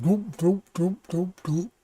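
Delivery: soft, high gulping noises; quiet, hollow glug sounds; imitated gulping. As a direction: mimic someone chugging from a big container. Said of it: "take giant gulps out of this".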